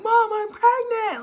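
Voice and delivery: high, slow, stunned voice; corrected retelling to mom, high and stunned; so shocked. Retold or imitated. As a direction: say it like you can't believe it yourself. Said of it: "Like, just so shocked".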